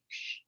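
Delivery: hushed, breathy shh; gentle shush with no voice in it; soft shushing. Shown as a demonstration. Aunt quieting kids without yelling, soft shush. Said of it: "Like that, shh".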